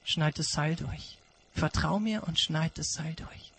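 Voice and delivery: an echo of God's words, haunting; quiet haunting repeat; hushed God voice. Acted.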